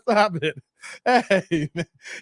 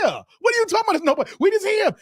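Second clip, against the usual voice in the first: mock shout; 11 semitones higher, much faster than usual. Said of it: "God is saying".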